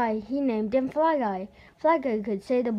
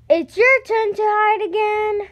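put-on much higher, louder kid voice; eager boy; 11 semitones higher, 7 dB louder than usual. Boy telling his fly to hide again, high. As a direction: bounce it like a happy boy.

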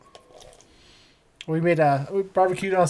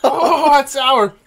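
pained exclaiming; 7 semitones higher, much slower than usual. Real, not acted. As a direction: react like the shot burns your throat. Reaction to strong drink.

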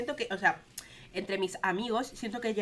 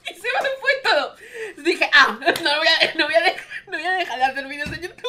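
higher, louder, fast words through laughter; laughing; 8 semitones higher, 9 dB louder than usual. Real, not acted. Telling viewers she won't quit, laughing loudly. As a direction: laugh through the whole line.

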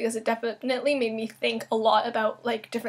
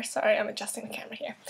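quick, breathy aside under the breath; whispered aside; 8 dB quieter, faster than usual. Real, not acted. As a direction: mutter it to yourself while fiddling with the camera. Aside while fixing the camera.

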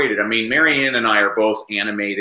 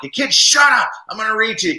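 put-on grumpy old man; 10 semitones higher than usual. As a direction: bark it like a mock cranky old-timer. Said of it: "I'd become grumpy old man".

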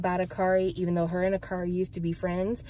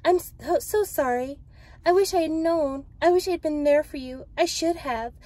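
higher-pitched, earnest apology; acted heartfelt apology, pitched up; Rachel; 8 semitones higher than usual. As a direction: plead your regret in character. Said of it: "she says".

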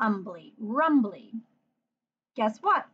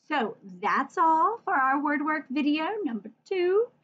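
ordinary talk, nothing marked

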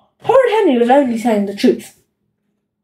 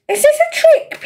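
put-on much higher, wary kid voice; suspicious little brother; 13 semitones higher than usual. A narrator's reading. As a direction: ask warily like a small boy. Peter suspicious of Henry.

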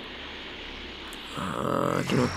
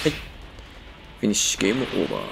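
low, quick game over; villain voice; 6 semitones lower, 5 dB quieter, faster than usual. Acted. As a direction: drop into a low finishing voice. Villain finish line. (低い声で決め台詞)

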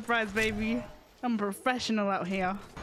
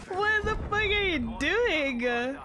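shocked; 8 semitones higher, slower than usual. Real, not acted. Shocked at enemy.

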